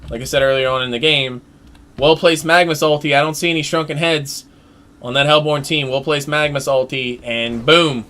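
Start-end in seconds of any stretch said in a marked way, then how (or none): none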